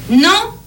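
« Non » lancé fort, en montant; relance interrogative. Acted. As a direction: insister par un « Non » interrogatif, aigu et fort. Pharmacienne relance la cliente qui refuse. (« Non » sonore et montant de vendeuse)

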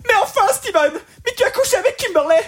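high, tearful film heroine; mock crying. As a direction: whine it like a weeping girlfriend in a dub. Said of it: "La fille pleure, elle arrive, elle fait".

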